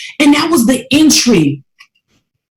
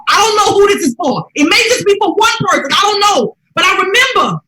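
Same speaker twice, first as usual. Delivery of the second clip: high, raised voice building the sermon; rising preaching; 8 semitones higher than usual. Real, not acted. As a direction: lift the congregation with it.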